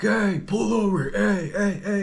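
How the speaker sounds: high, loud yell to pull over; imitating an uncle yelling from his moped; retold yelling. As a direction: holler at the van to stop. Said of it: "he's just screaming at this fucking crackhead".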